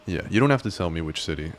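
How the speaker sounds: ordinary talk, nothing marked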